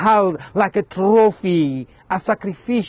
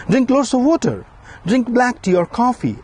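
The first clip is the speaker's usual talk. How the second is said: guest suggesting; 8 semitones higher than usual. Retold or imitated. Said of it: "a guest suggested".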